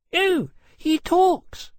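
very high, loud, drawn-out astonishment; shocked exclamation. A narrator's reading. Lady startled to hear a dragon talk, shocked exclamation. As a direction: exclaim it in a high-pitched lady's voice. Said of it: "Miss Montague exclaimed".

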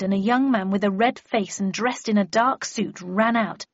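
plain reading voice

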